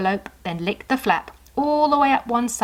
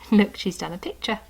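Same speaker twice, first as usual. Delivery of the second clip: ordinary delivery, nothing marked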